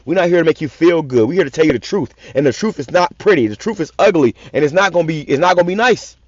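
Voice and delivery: ordinary talk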